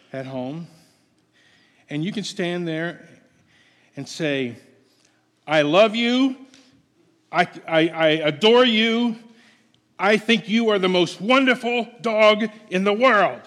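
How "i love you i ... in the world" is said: loud, scolding, higher pitched; harsh scolding tone. Shown as a demonstration. Preacher scolding a dog with loving words, loud and harsh. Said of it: "that dog will think that you are scolding him".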